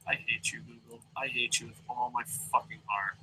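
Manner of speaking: very soft, low-energy curse; hushed, resentful grumble; quiet loathing. Real, not acted. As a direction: mutter it under the breath at a broken site. Muttered curse at Google, waiting.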